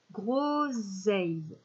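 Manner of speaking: groseille drawn out very slowly; slow dictation. Shown as a demonstration. Teacher dictating a word to the class, very slow. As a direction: say "Groseille" slowly, each syllable clear. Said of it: "le son ei".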